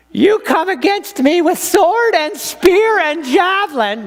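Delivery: put-on high-pitched odd voice of young David; weird small-boy voice. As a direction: squeak it out like a small brave boy. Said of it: "He's gonna have a weird voice".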